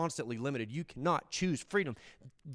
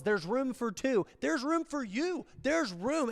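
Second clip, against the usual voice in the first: higher, slow and pleading There's room; Jesus's open invitation; 10 semitones higher, slower than usual. Retold or imitated. Jesus offering the thieves a place, higher and slow. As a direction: lift each line higher as an open welcome. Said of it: "The offer was".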